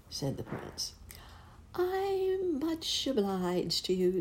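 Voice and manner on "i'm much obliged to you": high, slow mock politeness; sweetly polite dismissal; sweetly sarcastic. Princess politely refuses the prince.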